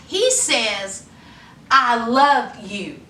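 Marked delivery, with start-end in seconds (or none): none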